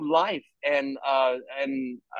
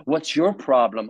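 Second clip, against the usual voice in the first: low, sneering accuser voice; Satan's taunt; 7 semitones lower than usual. Retold or imitated. Satan taunting the speaker for not reading more, low sneer. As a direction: needle them like a tempter. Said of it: "Satan will come and say".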